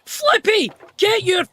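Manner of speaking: loud high yell of the name; exasperated shout at the character; yelling at Flippy. Real, not acted. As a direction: call him out sharply.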